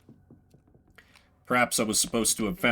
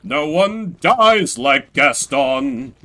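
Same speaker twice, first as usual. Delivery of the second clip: mock eulogy; 2 semitones higher, 8 dB louder, much slower than usual. Mock eulogy for dead janitor.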